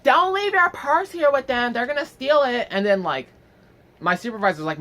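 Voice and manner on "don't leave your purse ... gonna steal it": high, drawn-out mock yell; imitated drunken yelling. Drunk customer turns back to warn others, yelling. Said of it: "turns around and starts yelling".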